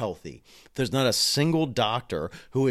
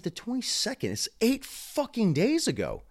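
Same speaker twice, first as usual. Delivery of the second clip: incredulous; 10 semitones higher than usual. Retold or imitated. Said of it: "I'm like".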